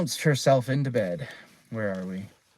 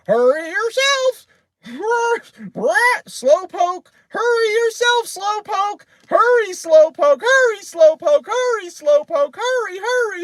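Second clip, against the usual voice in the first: very high, loud bird squawk; piercing, repetitive pet-bird mimicry; annoying parrot; 8 dB louder than usual. A narrator's reading. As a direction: screech it like a nagging parrot. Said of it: "That's a very annoying bird".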